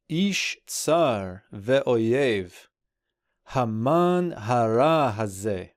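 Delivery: measured, slow Hebrew recitation; slow scripture reading. Acted. Teacher reading the Hebrew verse to students, slow and measured. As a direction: read the verse slowly and deliberately.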